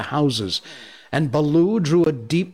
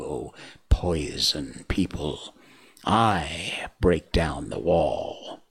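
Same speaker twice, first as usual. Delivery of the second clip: low, slow whispered hiss; menacing snake whisper; 6 semitones lower, slower than usual. Acted. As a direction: Kaa warns the cobras in a low whisper. Kaa threatens the cobras.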